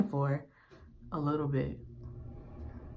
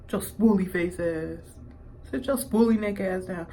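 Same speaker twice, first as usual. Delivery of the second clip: mock insult; 2 semitones higher than usual.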